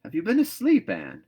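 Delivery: surprised to find her there; a loud, high startled question; astonished. A narrator's reading. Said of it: "said astonished Marilla".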